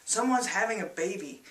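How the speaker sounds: ordinary delivery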